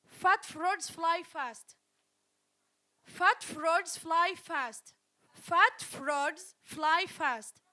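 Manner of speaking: slow, deliberate teacher model; teacher modeling for the class. Shown as a demonstration. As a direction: spell out each word. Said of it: "I give you another tongue twister".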